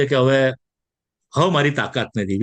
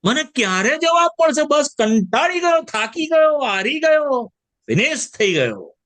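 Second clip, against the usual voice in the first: weary David; 8 semitones higher than usual. Retold or imitated. Preacher voices David worn out and pleading. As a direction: cry out like a worn-out psalmist. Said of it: "આ દાઉદ કે".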